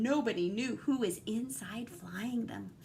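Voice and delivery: hushed, secretive whisper; whispered storytelling hush; spooky whisper. A narrator's reading. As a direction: breathe it like a secret in the dark. Spooky line in read-aloud story.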